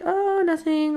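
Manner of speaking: high, drawn-out cover-up voice; feigned innocence. Retold or imitated. Boy caught by the bishop, high-pitched feigned innocence.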